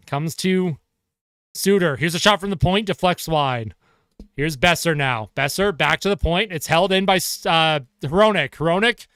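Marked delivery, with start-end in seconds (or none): none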